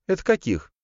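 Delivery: high female character voice; sister character. A narrator's reading. Sister asking her brother what business he has, high voice. Sister's line in narrated dialogue.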